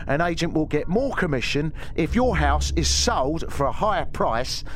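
menacing Cockney gangster explains realtors over ominous music; Bricktop impression. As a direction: lecture like a menacing Cockney hard man. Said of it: "maybe I should do a bit of Bricktop".

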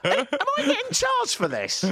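very high, incredulous squawk; comic high-pitched indignation; mock outraged passenger. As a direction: protest in a squeaky panic. Acts out outraged passenger in cab.

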